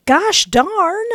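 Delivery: high, loud pained cry; pained outburst. Acted. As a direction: shout from the pain. Slammed against window.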